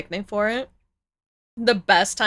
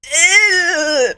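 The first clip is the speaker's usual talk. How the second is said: disgusted groan; 8 semitones higher, 6 dB louder, slower than usual. Real, not acted. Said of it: "I have the bad chills".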